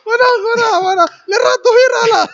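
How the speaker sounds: mock wail. Friend bursts into loud cry.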